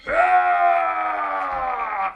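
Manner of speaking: a big loud whoop; cheering shout. Acted. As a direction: whoop for the start. Cheer as Hercules starts.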